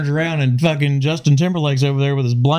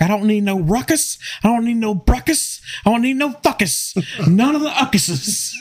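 high, rising squeal of a ranting counselor; hammed-up high camp counselor rant; camp counselor impression; 10 semitones higher than usual. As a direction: pitch climbs with each rhyme, mock fed-up. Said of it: "he's like".

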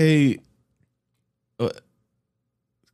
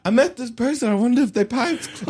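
mock poster voice; 9 semitones higher than usual. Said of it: "I would go on there like".

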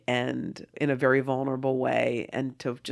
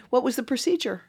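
much higher, nosy follow-up; prying seatmate; 11 semitones higher than usual. Retold or imitated. Seatmate digging into her procedure, nosy. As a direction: push the question up high, insistently curious.

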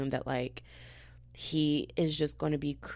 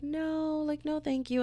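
high polite refusal; 11 semitones higher, slower than usual. Retold or imitated. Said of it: "I was like".

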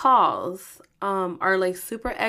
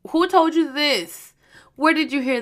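retold challenge, high and loud; sceptical questioning; 5 semitones higher, 5 dB louder than usual. Girlfriend doubting her boyfriend's latest rumour, sceptical questioning. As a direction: act out grilling someone, punchy and raised.

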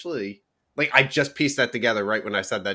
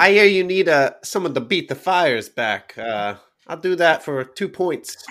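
mobster; 3 semitones higher, 6 dB louder than usual. Acted. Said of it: "isn't that what the mob does".

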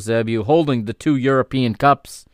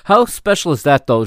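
excited; 3 semitones higher, 7 dB louder than usual. Real, not acted. Emotional pre-match ceremony.

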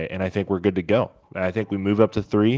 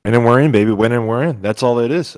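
excited; 7 dB louder, faster than usual. Real, not acted. Excited cohost answering rankings question.

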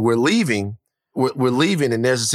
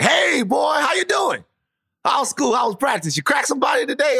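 high, hearty shouted greeting; boisterous father hailing his boy; happy dad; 14 semitones higher than usual. Retold or imitated. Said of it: "when he's happy".

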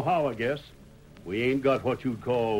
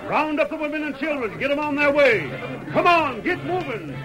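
high, hollered orders to the crowd; urgent shouting; 12 semitones higher than usual. Acted. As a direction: round up the townsfolk at the top of your voice. Townsman relays the alarm to everyone.

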